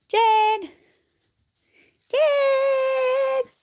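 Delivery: high, drawn-out call. Real, not acted. Vlogger calls to her sheep Jen, calling out. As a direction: call the sheep over from afar.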